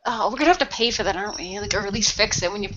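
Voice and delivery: ordinary talk, nothing marked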